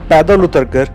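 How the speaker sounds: ordinary talk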